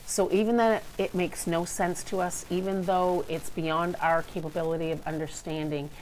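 ordinary talk

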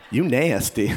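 low, teasing rapper flirt; mock-seductive rapper voice. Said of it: "[name] mode for a minute".

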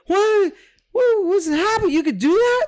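mock-horrified high squeal; very high, loud cartoonish shock; horrified disbelief. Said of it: "I immediately was horrified".

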